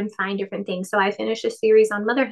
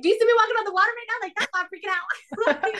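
much higher, fast, laughing mock panic; 11 semitones higher than usual. Pretending to be Peter panicking on the water, fast mock panic with a laugh. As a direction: blurt it out like you're sinking. Imagining herself sinking beside Christ.

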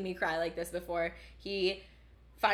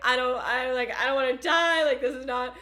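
louder, higher panicked plea, half-performed; imitating her sobbing panic; 6 semitones higher, 8 dB louder than usual. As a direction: replay the tearful "I don't wanna die" moment. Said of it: "like, sobbing".

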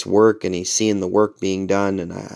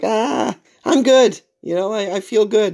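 very high, light quoted voice; breezy high-pitched self-quote; 12 semitones higher than usual. Retold or imitated. Patient telling chiropractor he is fine, high-pitched. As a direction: wave it off up high.

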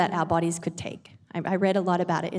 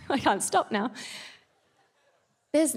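ordinary talk, nothing marked

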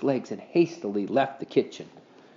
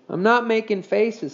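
put-on boy voice; 9 semitones higher than usual. A narrator's reading. Henry's voiced reply.

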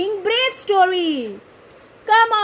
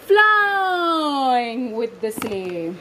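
slow drawn narration; 5 semitones lower, much slower than usual. A narrator's reading.

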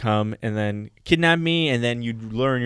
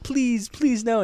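put-on pleading kid; 9 semitones higher, much slower than usual. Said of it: "I'd be like".

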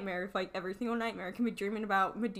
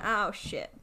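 ordinary delivery

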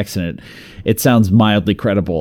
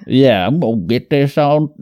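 put-on higher-pitched, half-hearted Southern yokel accent; mock Alabama accent; 5 semitones higher than usual. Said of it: "trying to do his accent".

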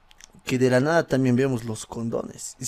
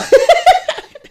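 risa alta y estallada; carcajada aguda; 8 dB louder than usual. Real, not acted. Carcajada tras leer. (carcajada aguda y fuerte)

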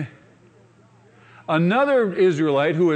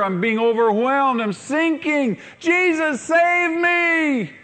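building to a high, loud shout; desperate cry; 10 semitones higher, 3 dB louder, slower than usual. Acted. Overwhelmed believer begs Jesus for rescue, shouting. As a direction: rise into a desperate plea. Sinking person cries to Jesus.

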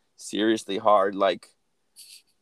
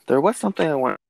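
ordinary talk

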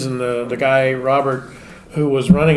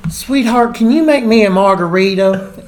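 high, sugary Southern belle drawl; a sweet old Southern lady calling you sweetheart; a rich Southern lady sweetly ordering a drink, high Southern accent; 7 semitones higher, 6 dB louder than usual. Retold or imitated. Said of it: "a southern little lady".

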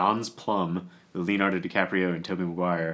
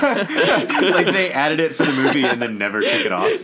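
high laughing disbelief; 7 semitones higher, 7 dB louder than usual. Real, not acted. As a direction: laugh through the words. Laughing at the omelet story.

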